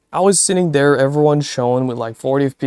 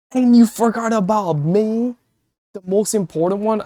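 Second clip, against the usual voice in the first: high, put-on offended voice; mock indignant; 7 semitones higher than usual. Streamer mock-scolding the friend who forgot him, pouting. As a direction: pout it like you've been snubbed.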